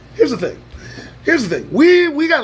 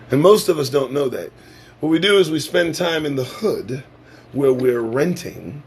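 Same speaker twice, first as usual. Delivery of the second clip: ordinary talk, nothing marked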